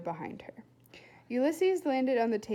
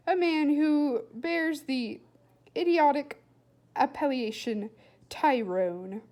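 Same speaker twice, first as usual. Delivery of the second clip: slow, louder, contemptuous emphasis; scornful; 5 semitones higher, 8 dB louder, slower than usual. A narrator's reading. William telling Flora about his stepfather, slow and scornful. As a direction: spit out the name with disdain.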